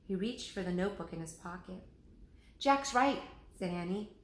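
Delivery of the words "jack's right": high, loud backing up; quick and sure; eager agreement. Acted. Annie backs up Jack to the president: high eager agreement.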